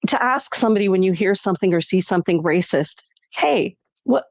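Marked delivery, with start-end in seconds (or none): 3.3-4.3 much higher, fast quizzical challenge; pointed question. Retold or imitated. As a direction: ask it like a sharp, surprised check. Suggested confronting question.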